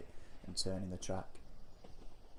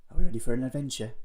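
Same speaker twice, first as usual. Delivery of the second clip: put-on louder, higher, beaming question to the kids; rally the kids with a big grin; eager, grinning call to adventure; excited grin; 4 semitones higher, 12 dB louder, faster than usual. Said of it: "grin widely at the kids".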